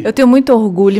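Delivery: loud, stress on muito; loud proud declaration. Real, not acted. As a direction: proclaim it proudly. Proud, laughing exchange.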